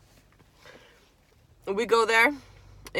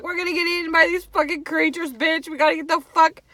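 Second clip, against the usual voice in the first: panicked; 8 semitones higher than usual. Retold or imitated. Panicking while locked in.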